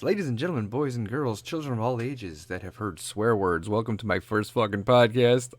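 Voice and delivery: low, hammed-up ringmaster announcement. Old episode opening announcement.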